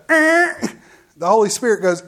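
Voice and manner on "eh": high, loud "eh" like a buzzer; abrupt mock nudge sound; imitated prodding noise. Holy Spirit stops the preacher leaving, high insistent noise. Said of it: "I get the".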